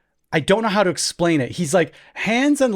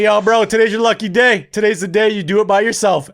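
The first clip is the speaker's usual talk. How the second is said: higher, raised pep-talk call; yelling down stairs; 6 semitones higher, 4 dB louder than usual. Retold or imitated. Babysitter repeating himself to kid below, yelling down. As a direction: project the encouragement downstairs. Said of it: "yelling from the top of the stairs".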